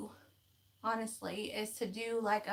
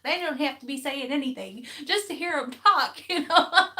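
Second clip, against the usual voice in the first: laughing through the words, louder; 5 semitones higher, 12 dB louder than usual. Real, not acted. Telling viewers she loves hearing Irish people talk, laughing. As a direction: gush and laugh at once.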